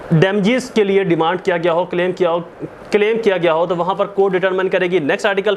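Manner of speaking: ordinary talk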